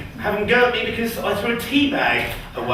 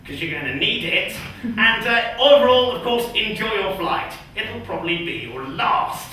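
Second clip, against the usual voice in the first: deadpan flight announcer; 2 semitones higher than usual. Acted. Cabin crew welcoming passengers aboard Descender, deadpan announcement. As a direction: mimic a cabin address, delivering doom as routine.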